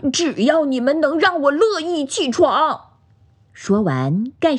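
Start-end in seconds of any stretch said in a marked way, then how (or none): 0.1-2.9 high-pitched, mildly raised voice; loud declaration. A narrator's reading. Bear throws off quilt to answer friends, high mock-loud. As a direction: announce it in a high, defiant bear voice. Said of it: "大声地说道".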